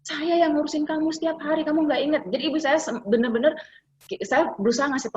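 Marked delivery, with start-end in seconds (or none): 0.0-2.2 higher, aggrieved plea; a touch of hurt complaint; hurt mother. Retold or imitated. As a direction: complain like a wounded wife. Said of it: "Dia bilang".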